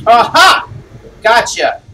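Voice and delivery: loud, high burst of triumph; triumphant gotcha. Real, not acted. Player catching a foe in the game, triumphant. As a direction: pounce with a loud, triumphant cry.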